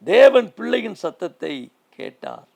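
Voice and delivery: climactic shout. Acted. Preacher proclaiming to the congregation that God heard the child, full-voice shout. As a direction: shout the verse like a revelation.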